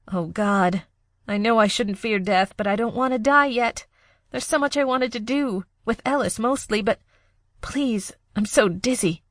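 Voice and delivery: pleading as she drifts off; dying young woman. Acted.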